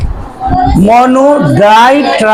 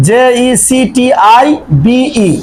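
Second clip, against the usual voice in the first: ordinary talk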